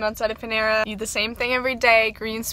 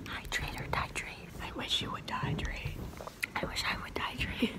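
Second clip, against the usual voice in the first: soft mock whisper; 12 dB quieter than usual. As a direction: whisper the joke. Joking whispered aside.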